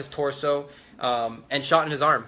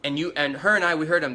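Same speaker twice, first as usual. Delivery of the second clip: plain reading voice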